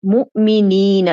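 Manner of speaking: مؤمنین said slowly, drawn-out یینا ending; slow model word. Shown as a demonstration. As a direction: stretch مؤمنین syllable by syllable. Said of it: "تو یہ بن جائے گا مؤمنین". (مؤمنین کو آہستہ، یینا کی آواز کھینچ کر)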